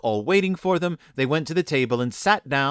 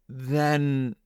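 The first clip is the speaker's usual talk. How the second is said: drawn-out, subdued motherly word; sad. A narrator's reading. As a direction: let it hang sorrowfully. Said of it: "said the mother sadly".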